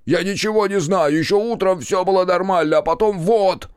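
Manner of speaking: high, loud, frantic protest; panicked, high-pitched outburst; hysterical yell. A narrator's reading. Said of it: "заорал Макар".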